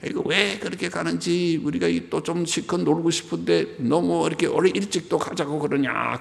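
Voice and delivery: high-pitched whine of a reluctant sheep; hammed-up protest, held high throughout; mock complaining. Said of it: "그러고 불평하지 않고".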